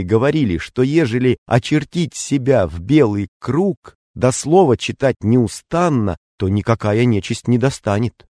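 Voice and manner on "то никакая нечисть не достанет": ordinary delivery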